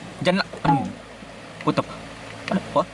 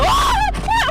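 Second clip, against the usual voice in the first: very high scream of fright; two sharp screams in panic; frightened scream; 4 dB louder than usual. Real, not acted. Screams at monster jump scare. (matinis na tili sa takot)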